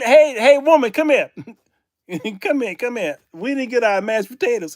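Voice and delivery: high, loud mock diners summoning a server; hammed-up impatient customer; imitated calling out. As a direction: flag down the waitress, exaggerated. Acts diners calling to supposed server.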